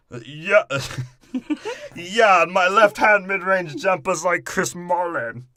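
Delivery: put-on loud, high comic German accent; mock German accent. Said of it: "That's my German accent".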